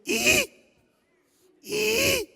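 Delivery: put-on high frustrated toddler squeal. Comedian imitating his two-year-old son's squeal. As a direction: squeal like a toddler who can't talk. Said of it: "He'd go".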